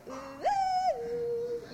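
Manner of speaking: high, wavering-in ghostly wail; long, high-pitched "ooh"; spooky ghost "ooh". Shown as a demonstration. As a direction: hoot like a ghost to set the mood. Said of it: "let's set the mood".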